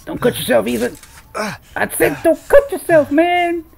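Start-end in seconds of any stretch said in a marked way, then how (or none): 1.3-3.6 exasperated yell. Real, not acted. Scolding the game character Ethan.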